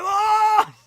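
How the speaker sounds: sudden high yelp of shock; imitating a startled shout; mock freak-out. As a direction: yelp it like you just saw a crash. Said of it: "Corey Graves started freaking out".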